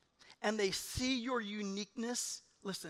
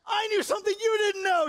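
much higher, louder cry of triumph; gloating excitement; 12 semitones higher, 7 dB louder than usual. Retold or imitated. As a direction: crow it like you finally won. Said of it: "You're, like, all excited".

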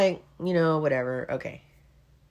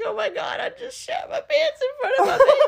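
high, laughing and crying through the words. Retold or imitated. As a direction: retell the meltdown, laughing while near tears. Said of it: "I had to run to the bathroom 'cause I was like".

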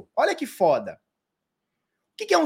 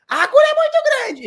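put-on much higher, loud, panicked child's shriek; daughter screaming; 5 dB louder than usual. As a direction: shriek like a little girl scared of the needle. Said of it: "Começou a gritar". (filha gritando apavorada com a agulha, voz aguda de criança)

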